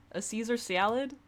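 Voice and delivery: high, loud mock Midwestern accent; NL's Midwestern accent. Barkeep suggesting food specials, in mock Midwestern accent. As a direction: put on a hammed-up Midwestern accent, rising in pitch. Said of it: "trying to do a Midwestern accent".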